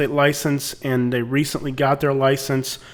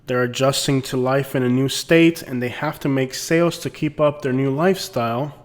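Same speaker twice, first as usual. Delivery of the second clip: ordinary talk, nothing marked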